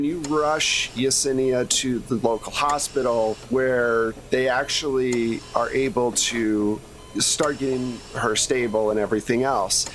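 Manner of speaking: normal talking voice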